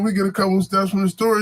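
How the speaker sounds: ordinary talk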